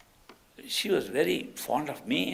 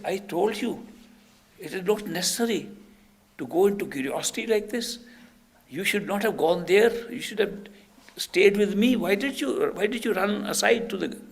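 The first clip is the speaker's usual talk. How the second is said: high-pitched, insistent telling-off; retold reproach, growing louder; acted scolding; 8 semitones higher, 4 dB louder than usual. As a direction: scold a disciple who disobeyed. Master scolds disciple in retelling.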